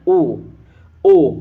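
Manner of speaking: ओ from the throat with rounded lips; o sound demo. Shown as a demonstration. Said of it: "इसका उच्चारण हो रहा है ओ". (ओ को कंठ और होठ से बोलकर)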